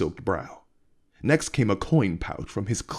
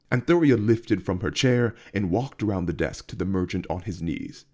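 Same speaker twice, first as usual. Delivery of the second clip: plain reading voice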